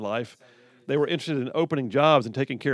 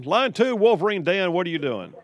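higher-pitched, punchy radio host welcome; on-air caller greeting; 5 semitones higher than usual. Real, not acted. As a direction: bring the caller on with big energy.

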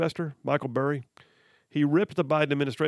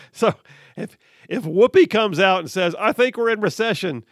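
louder and higher, building up; excited; 5 semitones higher, 8 dB louder than usual. Real, not acted. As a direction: pitch it up with disbelief. Excited build about Whoopi.

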